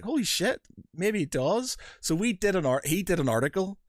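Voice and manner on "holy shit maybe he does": dawning amazement. Retold or imitated. Host hearing the credentials, high amazed. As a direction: realise it might be true. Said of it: "I was like".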